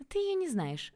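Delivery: high, lightly dismissive daughter; airy, high-pitched brush-off. A narrator's reading. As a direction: brush the question off, pitched well up. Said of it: "отмахнулась Таня".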